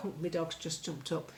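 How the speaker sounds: ordinary talk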